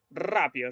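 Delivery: "rápido" with a strongly rolled R; Spanish tongue-trilled R opening "rápido". Shown as a demonstration. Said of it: "el sonido de la R española".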